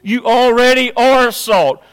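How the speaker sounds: loud, high, slowed preaching; fiery pulpit declaration; full-force preaching. Acted. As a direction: thunder it out to the congregation.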